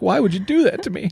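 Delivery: high-pitched wounded puppy voice; puppy's voice, pitched well up; horrified puppy. Acted. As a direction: plead like an appalled little dog. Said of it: "looks at me in horror".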